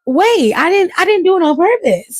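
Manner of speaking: high, hurried protest of innocence; lightly voiced defensive Punk; mock pleading innocence. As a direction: talk him down, acting innocent. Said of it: "C M Punk's like".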